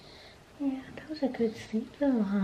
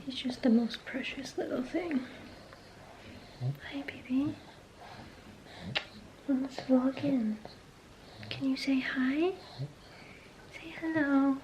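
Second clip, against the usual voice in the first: soft whisper; 2 semitones higher, 9 dB quieter than usual. Real, not acted. Whispering to baby on camera.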